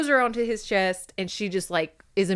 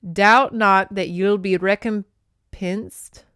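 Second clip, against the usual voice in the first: slow, measured Highland voice; calm Scottish lord; 6 dB louder, much slower than usual. Acted. Captor thanks her for healing his men, slow and calm. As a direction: weigh each word, slow and grave. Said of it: "he said calmly".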